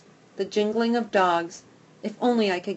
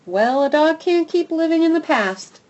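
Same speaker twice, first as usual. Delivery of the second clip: louder, higher brisk dog voice; brisk Goldie; 9 semitones higher, 8 dB louder than usual. Acted. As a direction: set her straight, matter-of-fact. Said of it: "Goldie says".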